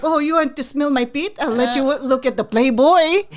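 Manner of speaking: accented jokester uncle, playing it up; Uncle Romy. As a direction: tease like the playboy uncle. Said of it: "And he's like".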